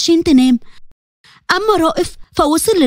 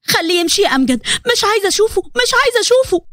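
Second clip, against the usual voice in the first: higher and higher, pushing him away; rising outburst; 5 semitones higher than usual. Acted. Wife begging her brother to send her husband away, climbing in pitch. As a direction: start pleading, end nearly crying out.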